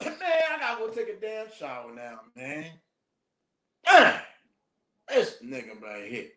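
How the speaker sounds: mock disgust, grumbling about a shower; disgusted complaint. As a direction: complain like you've been soiled. Senior complaining about needing shower.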